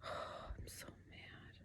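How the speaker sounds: very quiet, slow sigh of frustration; real frustration, hushed and drawn out; mad, deflated. Real, not acted. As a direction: sigh it out almost in a whisper.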